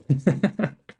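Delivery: quick amused laugh; brief chuckle; short laugh. Real, not acted. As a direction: laugh at your own joke. Laughs at own mock quote.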